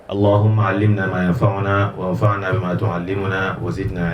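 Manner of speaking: rapid formal opening prayer; prayer recitation. Real, not acted. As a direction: recite the opening blessings quickly.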